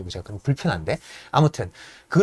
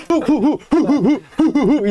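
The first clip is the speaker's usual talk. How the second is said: huffing laugh; 10 semitones higher, 3 dB louder, much slower than usual. Retold or imitated. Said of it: "이러는 애예요".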